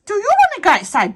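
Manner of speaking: very high sing-song questions. Real, not acted. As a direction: coax the dogs outside. Asking dogs.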